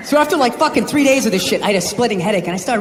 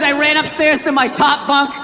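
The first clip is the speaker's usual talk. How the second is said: normal talking voice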